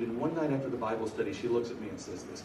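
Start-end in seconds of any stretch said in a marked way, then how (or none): none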